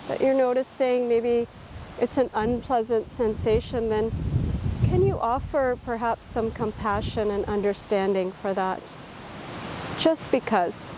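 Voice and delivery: unhurried, calm body-scan voice; guided meditation. Real, not acted. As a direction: guide them gently through a body scan, leaving space between phrases.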